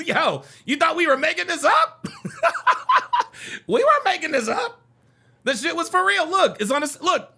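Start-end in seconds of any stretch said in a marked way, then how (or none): 0.1-2.1 high laughing shout; laughing hard. Real, not acted. Laughing at chat. 2.1-3.6 high squealing laugh; shrieking laugh. Real, not acted. Loud laugh. 3.7-4.8 high laughing shout; laughing hard. Real, not acted. Laughing at chat.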